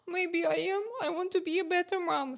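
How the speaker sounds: gasp, then high anxious self-doubt; worried reaction. Acted. Mother reacts to being called a bad mother, acted worry. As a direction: act stung and worried, pitched high. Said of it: "for me, it will be like".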